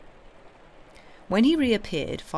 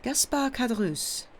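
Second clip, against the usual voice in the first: put-on higher-pitched name in a heavy Italian accent; 7 semitones higher than usual. A narrator's reading. Priest repeating the innkeeper's name, heavy Italian accent. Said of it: "with a very heavy Italian accent".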